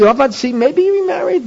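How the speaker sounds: mock objection. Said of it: "you can't say".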